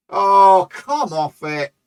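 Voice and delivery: loud exasperated Oh, then a slow disgusted come off it; explode, then drag out the disbelief; real exasperation at a conceded goal; frustrated outburst. Real, not acted. Team concedes, he reacts.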